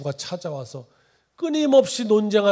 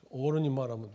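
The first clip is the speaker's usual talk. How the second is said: ordinary talk, nothing marked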